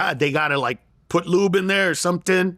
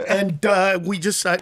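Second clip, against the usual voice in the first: ordinary talk, nothing marked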